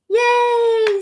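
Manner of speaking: very high squealed yay; baby cheer. Acted. Baby Louie cheering, very high squeal. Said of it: "said baby Louie".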